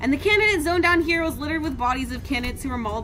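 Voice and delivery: low, soft, measured reading; quiet storybook narrator voice; game narration. A narrator's reading. Streamer reading out a battle aftermath, low measured narration.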